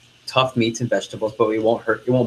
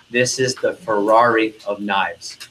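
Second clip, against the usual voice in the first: ordinary talk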